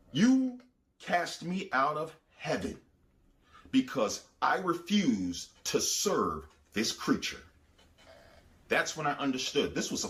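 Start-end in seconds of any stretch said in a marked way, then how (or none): 0.1-7.4 slow, higher, bitter demon voice; bitter fallen angel. Retold or imitated. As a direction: drag out each word with bitter resentment. Fallen angel complaining to God.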